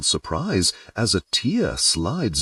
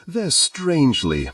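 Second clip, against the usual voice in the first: sniffling; 9 semitones higher than usual. A narrator's reading.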